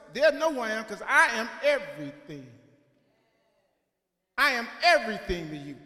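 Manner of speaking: loud preaching proclamation; proclaiming God. Retold or imitated. God proclaiming himself to Moses, loud and full. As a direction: declare it to the whole congregation. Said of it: "He says".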